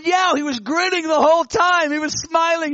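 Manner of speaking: high, eager voice for Bob; excited retelling. Retold or imitated. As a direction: let Bob gush about the grinning Lord. Bob retells his vision excitedly.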